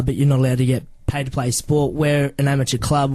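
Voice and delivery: ordinary delivery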